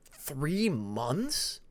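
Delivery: quiet, amazed thought to himself; hushed astonishment at the news; amazed inner thought. A narrator's reading. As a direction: murmur it in disbelief. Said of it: "thought to himself, amazed".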